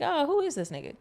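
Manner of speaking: impressed listener. Retold or imitated. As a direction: act like a stranger who just heard something fresh. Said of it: "be like".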